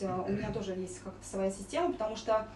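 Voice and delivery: usual speaking voice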